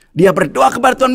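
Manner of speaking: ordinary talk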